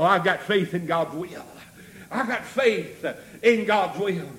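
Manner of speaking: normal talking voice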